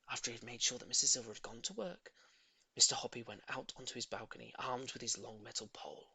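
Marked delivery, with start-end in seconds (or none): none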